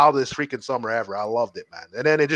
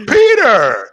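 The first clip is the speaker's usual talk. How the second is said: louder call of the name; mock yell; 6 semitones higher, 7 dB louder than usual. Retelling the friend calling out, mock yell. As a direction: holler his name across the room. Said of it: "He's like".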